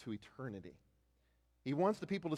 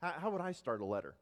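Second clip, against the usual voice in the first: ordinary delivery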